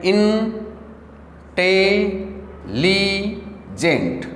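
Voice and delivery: Intelligent split into four slow, held syllables. Shown as a demonstration. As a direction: say इन... टे... ली... gent with pauses. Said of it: "four syllable intelligent के हो गए". (Intelligent को तोड़कर, धीरे-धीरे)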